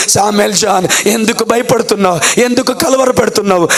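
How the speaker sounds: God's question answered with rising pleas; pleading preacher's climax. Acted. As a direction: press the question, then break into a high cry.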